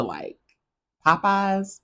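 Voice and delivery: ordinary talk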